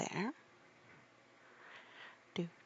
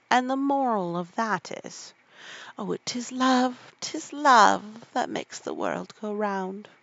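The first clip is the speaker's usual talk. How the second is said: Duchess voice; 3 semitones higher, 7 dB louder than usual. A narrator's reading.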